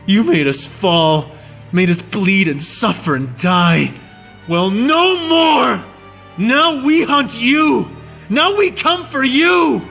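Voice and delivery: high, fierce war-cry defiance; a human soldier vowing revenge on his captor; vengeful fury. A narrator's reading. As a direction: declare the hunt with burning hatred.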